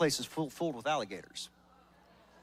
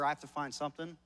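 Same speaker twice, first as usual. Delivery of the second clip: ordinary delivery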